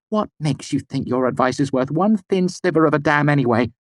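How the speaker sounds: high, angry outburst; burst out. A narrator's reading. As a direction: explode at him. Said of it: "Denna burst out".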